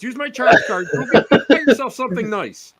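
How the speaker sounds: high, loud comic begging; mock pleading. Giving charge cards to the scary friend, mock pleading. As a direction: offer it up in high, exaggerated desperation.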